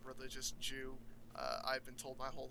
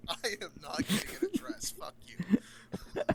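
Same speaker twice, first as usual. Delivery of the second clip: laughing loud protest; 6 semitones higher, 7 dB louder, slower than usual. Real, not acted.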